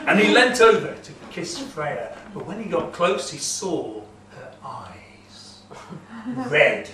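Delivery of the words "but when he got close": plain reading voice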